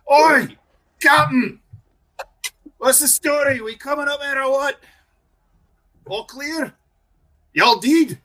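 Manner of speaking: high, loud, gruff dwarf call; gruff piercing voice. Acted. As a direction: bark it up the road at the captain. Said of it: "a gruff, piercing voice".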